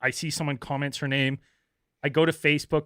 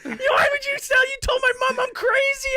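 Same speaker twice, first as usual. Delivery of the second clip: much higher, louder screeching rant; mock furious shriek; 5 dB louder than usual. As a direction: shriek it as the enraged caller. Angry caller voiced shrieking.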